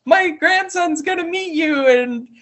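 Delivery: high mock-cheery resort lady voice; mocking the lodge owner. Mocking the resort owner who invites guests despite a death, high fake-cheerful. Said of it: "I got mad at her. I was like".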